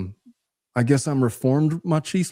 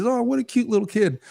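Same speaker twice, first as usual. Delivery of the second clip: high adult voice; 10 semitones higher than usual. Retold or imitated. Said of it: "I was like".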